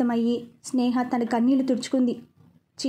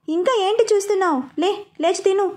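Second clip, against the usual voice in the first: higher, sharper command to get up; voice slightly raised; 6 semitones higher than usual. A narrator's reading. Husband ordering a staring wife to eat, voice raised. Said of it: "అన్నాడు కొంచెం voice పెంచి".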